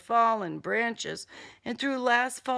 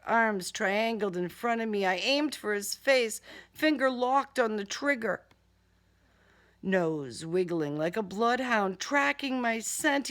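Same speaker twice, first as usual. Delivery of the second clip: ordinary delivery, nothing marked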